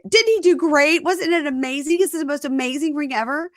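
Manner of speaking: high, excited mock gush; over-the-top gushing. Fiancée seeking praise for her ring, excited gushing. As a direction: push for praise in a high rush.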